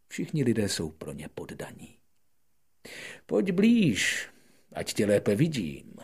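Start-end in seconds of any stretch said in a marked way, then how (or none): 3.3-6.0 high, loud, pompous king voice; proud king. Acted. Proud king calling the prince closer, high and loud. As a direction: summon him like a proud monarch. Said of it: "řekl mu král a byl moc pyšný".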